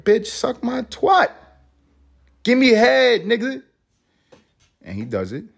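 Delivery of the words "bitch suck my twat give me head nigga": high, loud, slow mock brash woman; imitating the rapper. Retelling how the young rapper treats men, high mocking woman's voice. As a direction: ham up her bossy demand. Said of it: "And she's like".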